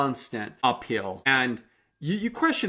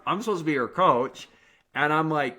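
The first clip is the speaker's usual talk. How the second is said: normal talking voice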